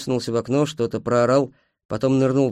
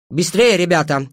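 raised, higher voice of command; 6 semitones higher, 7 dB louder than usual. A narrator's reading. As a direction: order the group to hurry. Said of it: "скомандовала она". (командным голосом, громко)

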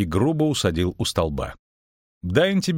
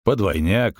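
plain reading voice

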